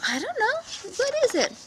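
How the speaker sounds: very high puzzled deer; playing innocent. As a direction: feign confusion. Deer keeps playing dumb.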